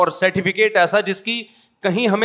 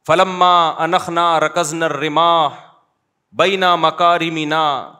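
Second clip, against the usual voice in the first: slow, drawn-out classical Arabic declamation; poem recitation; slower than usual. Acted. Teacher reciting Mutanabbi to show old Arabic, slow. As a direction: savour each word of the old verse.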